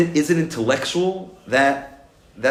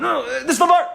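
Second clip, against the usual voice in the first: excited; 3 dB louder than usual. Retold or imitated. Excitedly explaining to his chavrusa.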